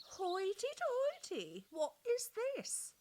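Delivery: high, slow haughty exclamation; pointedly. A narrator's reading. Chrysanthemum scolding Mamie, high pointed haughty voice. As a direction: look down your nose at the intruder. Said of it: "said so pointedly".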